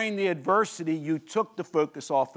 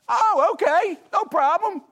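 high, mocking "oh, okay, no problem"; sarcastic disciples; 7 dB louder than usual. Disciples scoff at Jesus walking out, high and mocking.

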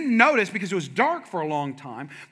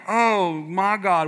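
shocked; 4 dB louder, much slower than usual. Retold or imitated. Dad shocked.

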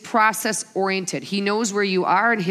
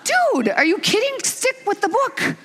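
very high, squeaky comic protest; hammed-up exasperated mom; mock exasperation; 13 semitones higher than usual. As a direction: protest way up high, half laughing. Exasperated mother answering teasing son.

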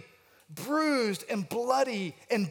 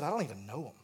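lower, soft, wounded repeat; hurt echo; 6 semitones lower, 6 dB quieter, much faster than usual. Retold or imitated. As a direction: let the words sting quietly. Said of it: "One of his best friends has just said".

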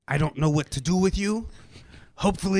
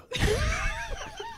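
high shout laugh; 5 dB quieter than usual. Real, not acted. Shocked laugh.

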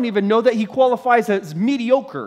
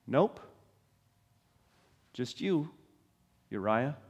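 soft, dry reply; flatly abandon him; deadpan soldiers; 7 semitones lower, 9 dB quieter than usual. Retold or imitated. Soldiers answer Uriah, soft and deadpan.

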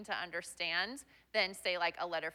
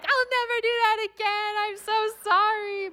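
put-on high, loud, weepy kid's apology, much higher; tearful mock-sob apology, pitched way up; imitating crying apology; 13 semitones higher, 7 dB louder than usual. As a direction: wail the apology like a sobbing camper. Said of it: "immediately starts crying".

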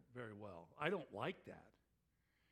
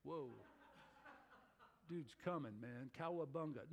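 surfer dude; 2 semitones lower, 7 dB quieter than usual. Retold or imitated. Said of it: "you don't just stand there and go".

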